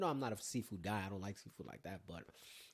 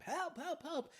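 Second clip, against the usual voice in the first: retold cry for help; slower than usual. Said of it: "screaming top of my lungs".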